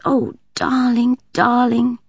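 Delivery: slow, high and tender crooning; gentle mother's croon; soothing mother. A narrator's reading. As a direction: comfort a wailing baby. Mother soothing crying baby.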